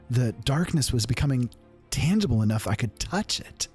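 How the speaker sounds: ordinary delivery, nothing marked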